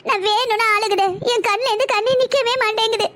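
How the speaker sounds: high, tearful wail; crying helplessly. Acted. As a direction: protest through unstoppable tears. Mother cannot stop crying. (அடக்க முடியாத அழுகையோடு)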